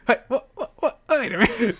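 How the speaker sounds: high, disbelieving, laughing; real shock dissolving into laughter; startled giggle at a surprise guest. Real, not acted. Hearing she was on their show.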